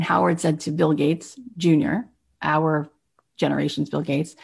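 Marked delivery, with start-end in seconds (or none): none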